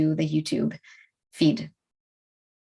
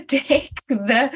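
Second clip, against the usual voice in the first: ordinary delivery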